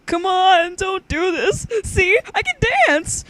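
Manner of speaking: high, loud pleading hand voice; begging puppet. Acted. Hand puppet begs not to be hit, high loud pleading. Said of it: "she begged through the hand".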